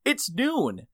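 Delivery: loud, high indignant telling-off; retold yelling. Worker confronts late regional manager, angrily indignant. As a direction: scold the boss for breaking a commitment. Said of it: "yelled at anybody".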